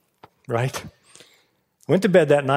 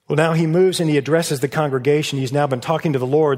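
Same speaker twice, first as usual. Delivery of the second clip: ordinary talk, nothing marked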